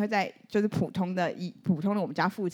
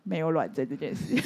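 mother's flat punchline; 5 semitones lower, 3 dB quieter than usual. Retold or imitated. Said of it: "那我妈就说".

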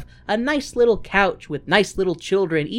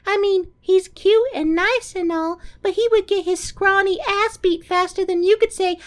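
squeaky sweet Audrey impression; high breathy Audrey voice; 4 dB louder than usual. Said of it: "Audrey Classic is like".

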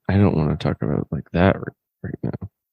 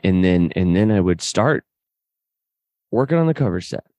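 normal talking voice

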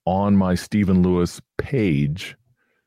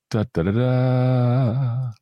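high, slow sung drum roll; vocal drum roll; 7 semitones higher, much slower than usual. Pretend. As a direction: hum a drum roll for suspense. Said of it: "drum roll".

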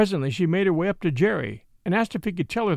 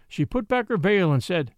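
ordinary delivery

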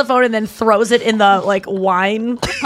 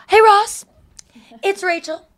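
put-on high, loud drunk voice calling her ex; hammed-up drunk phone call; tipsy Rachel; 10 semitones higher, 4 dB louder than usual. Drunk woman leaving a message for her ex, high and loud. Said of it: "she goes".

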